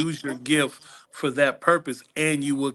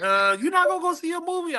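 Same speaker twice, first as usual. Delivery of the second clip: mimicking the CEO. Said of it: "I was like".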